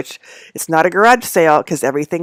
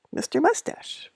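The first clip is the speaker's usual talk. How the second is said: hushed unvoiced call to a hiding cat; breathy whispered Mister Mustache; whispered name; 11 semitones higher, 10 dB quieter than usual. Real, not acted. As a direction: whisper the cat's name fondly. Calling softly for the cat.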